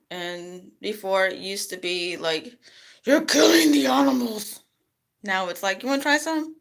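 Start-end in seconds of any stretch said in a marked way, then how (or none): 3.0-4.6 mock preachy vegan accusation. 5.8-6.6 sweet friendly offer. Retold or imitated. Friendly offer, high far voice.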